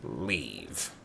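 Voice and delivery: ordinary talk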